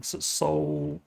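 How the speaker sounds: plain reading voice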